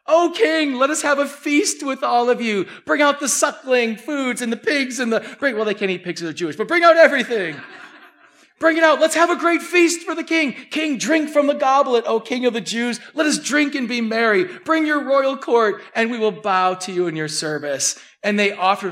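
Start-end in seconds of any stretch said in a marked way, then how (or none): 0.1-5.2 sarcastic feast call, pitched high and loud; mock royal toast. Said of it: "as a mockery". 8.6-18.0 high and loud sarcastic toast; hammed-up courtier bowing in scorn; mock royal feast. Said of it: "laughing and giggling".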